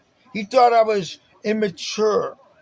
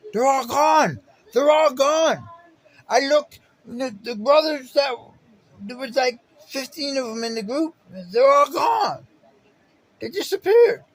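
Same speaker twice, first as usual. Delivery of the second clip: louder and higher, retelling the panic; alarmed retelling; 7 semitones higher, 4 dB louder than usual. Retold or imitated. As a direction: call it out as if still searching. Retelling panic, brothers vanished.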